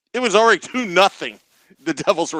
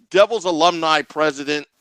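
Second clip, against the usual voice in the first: ordinary delivery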